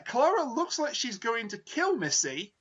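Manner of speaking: normal talking voice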